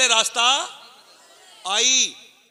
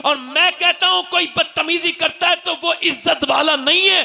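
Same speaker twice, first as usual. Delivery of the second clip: impassioned preaching; 10 semitones higher than usual. Acted. Preacher rouses the gathering about Bilal and Abu Jahl, shouting. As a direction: drive it home to the crowd at full force. Preacher builds to full force.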